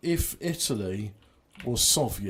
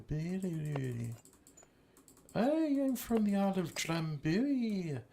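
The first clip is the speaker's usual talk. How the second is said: higher, hammy Scottish brogue with och aye; a jokey Highland laird; gruff scottish lord; 3 semitones higher, 4 dB quieter than usual. Pretend. Plays a Scottish local.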